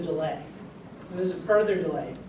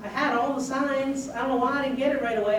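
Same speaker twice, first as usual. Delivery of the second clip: put-on higher-pitched little country kid accent; cute country accent; 6 semitones higher than usual. Boy telling his doctor about missed signs, cute country accent. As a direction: imitate a young boy's country twang, pitched up. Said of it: "in his cute little country accent".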